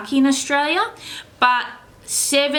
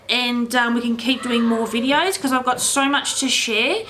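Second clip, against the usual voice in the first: normal talking voice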